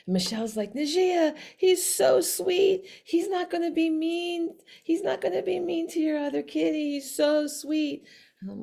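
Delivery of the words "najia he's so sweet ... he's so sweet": high, pleading gush; imitating a friend begging sweetly; cooing plea. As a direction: coax in a high sing-song.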